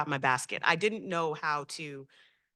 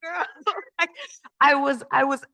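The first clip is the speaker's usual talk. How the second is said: laughing; 9 semitones higher, 5 dB louder than usual. Real, not acted. Laughing at own overwhelm.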